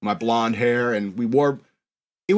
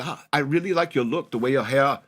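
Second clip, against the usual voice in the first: put-on European lady's accent; 2 semitones higher than usual. European lady approaches a kid about a commercial, mock German accent. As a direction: put on a German woman's accent, a touch sing-song. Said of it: "European-sounding lady come and approach me".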